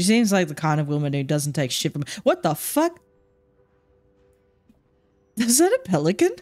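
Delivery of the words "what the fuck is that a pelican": startled outburst. Real, not acted. Suddenly sees a pelican.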